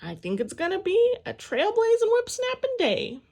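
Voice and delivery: high, loud, eager shout; excited. A narrator's reading. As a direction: try hard to get Dad's attention. Girl tries again to get Dad.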